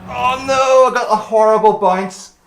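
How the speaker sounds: real racing dismay, pitch jumping up; dismayed cry. Real, not acted. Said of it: "Sorry, I'm getting emotional now".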